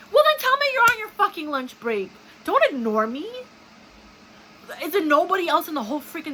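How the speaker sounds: high, loud furious rant; yelling at a clerk who ignores her; angry outburst. Real, not acted. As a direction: let the anger burst out at the clerk.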